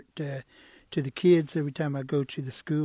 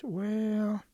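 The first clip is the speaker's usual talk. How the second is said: long drawn-out, higher "well"; reluctant wavering; 6 semitones higher than usual. Acted. Storyteller as Turtle nearly agreeing to lend, reluctant wavering. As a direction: hesitate before grudgingly giving in.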